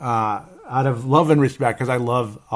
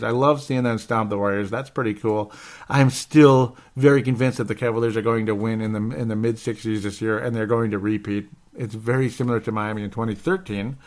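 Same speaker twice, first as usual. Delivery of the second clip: ordinary talk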